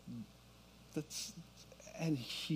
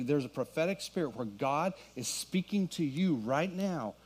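ordinary delivery

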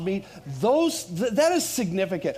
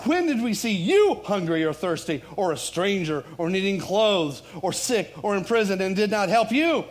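loud, rhythmic preaching of a list; declaimed scripture; 3 semitones higher, 4 dB louder than usual. Real, not acted. Pastor reading the judgment of the goats, declaimed scripture. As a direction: declaim each charge with a lift, then a drop.

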